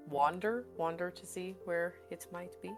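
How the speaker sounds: normal talking voice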